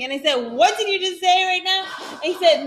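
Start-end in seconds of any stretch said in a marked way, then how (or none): 0.5-1.8 indignant demand. Retold or imitated. Retold indignant comeback to husband.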